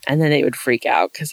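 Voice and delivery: ordinary talk, nothing marked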